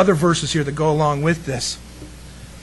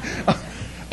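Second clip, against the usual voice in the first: soft breathy laugh; 6 dB quieter than usual. Real, not acted. Laughs at own joke.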